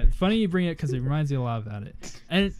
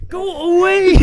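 high-pitched, drawn-out loud yell; 13 semitones higher, 11 dB louder, slower than usual. Retold or imitated. Retelling how he sent his brother away, high yell. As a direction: shriek it out in mock horror.

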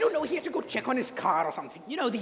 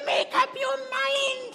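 high, drawn-out exasperated cry; exasperated nanny; 12 semitones higher, 4 dB louder, slower than usual. Acted. Nanny pressing dithering employer, exasperated high voice. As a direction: demand an answer, very high and slow.

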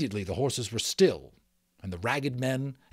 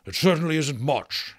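grumpy mayor, louder and higher; complaining; 4 semitones higher, 6 dB louder than usual. A narrator's reading. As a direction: complain about the meagre takings. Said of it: "he complained".

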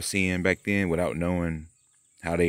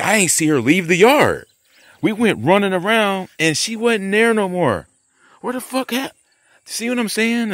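excited; 11 semitones higher, 4 dB louder than usual. Real, not acted. Excited memory rising in pitch.

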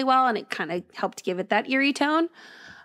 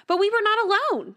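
much higher, louder protest; 11 semitones higher, 3 dB louder than usual. A narrator's reading. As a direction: protest it, pitched up high. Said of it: "Jackson protested".

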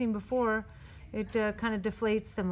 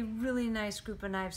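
usual speaking voice

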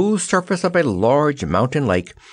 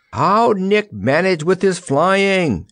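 slow, high-pitched shriek; drawn-out high call; 11 semitones higher, slower than usual. A narrator's reading. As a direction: shriek the question across the wind. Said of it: "shrieked the Wizard".

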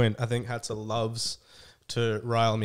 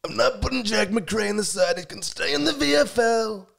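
put-on Donald Trump accent, much higher and a bit louder; Trump impression; 9 semitones higher, 3 dB louder than usual. Guest picking Bulldogs players on request, in a Trump voice. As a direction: bluster like Trump picking the team. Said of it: "About in the Trump voice?".

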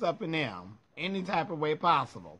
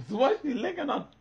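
laughing question; 9 semitones higher, 4 dB louder than usual. Retold or imitated. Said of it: "Like, girl".

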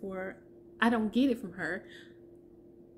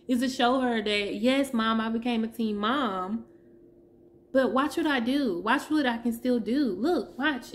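normal talking voice

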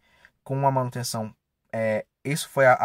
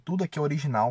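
usual speaking voice

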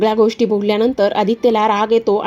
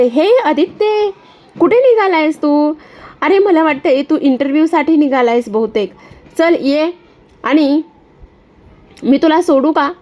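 Disha's sly voice; 5 semitones higher, 3 dB louder than usual. Acted. Disha calling from her car to Aditya, high and mocking. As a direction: sweet-talk him with a sneer.

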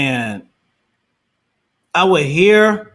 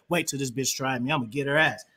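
low, hushed inner thought; scheming aside; 7 semitones lower, 10 dB quieter than usual. Retold or imitated. Diner privately plotting against his date, hushed scheming aside. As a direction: drop to a secretive undertone.

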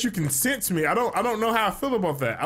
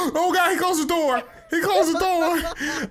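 panicked gasp; 11 semitones higher, slower than usual. Real, not acted. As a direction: gasp as the door slams shut. Trapped with the hunter.

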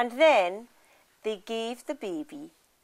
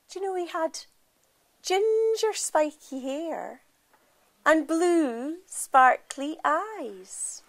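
higher, very slow and drawn out; slow hushed-wonder reveal, higher; slow dramatic reveal; 7 semitones higher, 4 dB louder, slower than usual. A narrator's reading. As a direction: linger on each word in wonder. Same traits found again.

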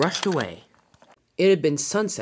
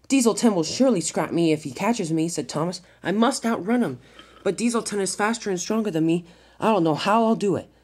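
high, urgent character voice; worried little engine; 6 semitones higher, 3 dB louder than usual. A narrator's reading. Thomas fleeing through the quarry, worried high voice. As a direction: fret aloud, pitched up.

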